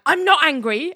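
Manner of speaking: high, insistent not angry; mock denial. Mocking Ali insisting to Larry she's not angry. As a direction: snap back a denial. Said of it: "And Ali's like".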